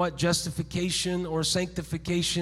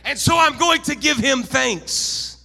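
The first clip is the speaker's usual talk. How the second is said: loud, high, impassioned sermon delivery; full-force preaching; 5 semitones higher, 7 dB louder than usual. Real, not acted. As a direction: drive it home to the congregation, voice raised.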